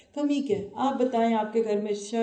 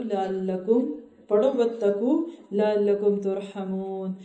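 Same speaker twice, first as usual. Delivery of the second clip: Quranic recitation; slower than usual. Real, not acted. Recites Quran verse in Arabic.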